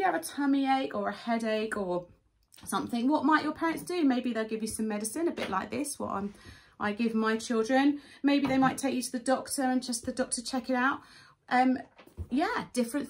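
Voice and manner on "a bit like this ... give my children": normal talking voice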